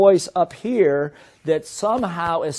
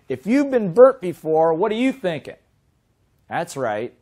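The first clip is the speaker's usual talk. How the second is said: ordinary delivery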